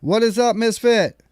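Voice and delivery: loud high greeting; calling out. Real, not acted. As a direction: call out to the caller.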